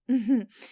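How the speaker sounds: a short, amused laugh that falls off. Acted. Boss to a new applicant, short amused laugh.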